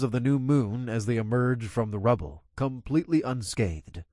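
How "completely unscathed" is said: usual speaking voice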